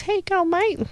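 squeaky high talking parrot; parrot impression. Owner imitating her parrot's greeting, very high. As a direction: chirp it way up high like a pet bird. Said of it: "I taught him how to talk, and he goes".

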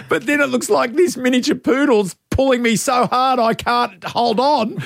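high, laughing retelling; laughing while talking. Real, not acted. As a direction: tell it through laughter. Laughing through a funny story.

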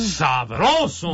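slow, savouring exclamation; drawn-out catchphrase. Pretend. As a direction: stretch the word out with relish. Host answers with slow catchphrase.

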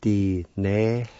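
"Di-ne-th-tin" slowly with gaps, HL sounded; slow syllables. Shown as a demonstration. Teacher showing the HL sound, "Di-ne-th-tin" slowly in syllables. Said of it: "like this".

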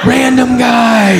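high, drawn-out dread; panicked realization. Acted. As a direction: stretch it out in comic panic. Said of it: "Now I'm panicked".

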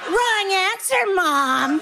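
drawn-out, high singsong correction; scolding kid. Acted. Kids to mom in zombie game, scolding kid. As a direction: buzz mom out like a bossy child.

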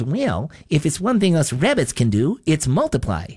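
cartoon rabbit voice with swooping pitch; Bugs Bunny. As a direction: imitate a wisecracking cartoon rabbit. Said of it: "Bugs Bunny and he says".

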